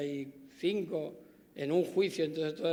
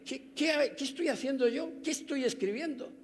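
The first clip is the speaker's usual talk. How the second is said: much higher, in sudden disbelief; as if catching your own mistake; stunned self-questioning; 8 semitones higher than usual. Retold or imitated. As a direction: judge realising his rulings make no sense, much higher. Judge's self-reproaching realisation.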